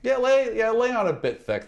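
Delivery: ordinary talk, nothing marked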